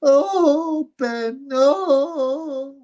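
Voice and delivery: high, wailing mock sobs; hammed-up crybaby wail; mock crying. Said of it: "crying like a little bitch".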